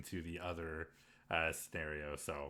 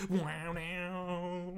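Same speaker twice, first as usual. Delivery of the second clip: mock disbelief; 9 semitones higher than usual. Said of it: "Just like".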